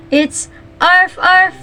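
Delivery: high playful arf arf; dog bark. Shown as a demonstration. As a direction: bark like a puppy. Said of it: "what the sound of a dog".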